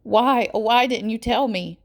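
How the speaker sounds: pleading wail with anger and pain; almost a wail. A narrator's reading. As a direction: let it break into a wail. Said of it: "It's almost a wail".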